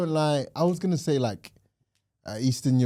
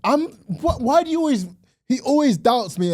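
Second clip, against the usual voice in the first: high-pitched, stammering indignation; indignant protest; 9 semitones higher than usual. Real, not acted. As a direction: protest being doubted, voice jumping high. Protests at being doubted by co-host.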